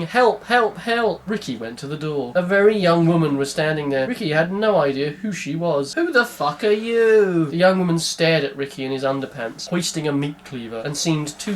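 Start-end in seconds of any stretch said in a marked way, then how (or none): 0.0-1.2 high, frightened screams; panicked repeated cries; screaming. Acted. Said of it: "kept on screaming". 5.9-7.5 high, slow, aggressive question; shouted challenge at the door; demanding. Acted. Ricky confronts girl at door.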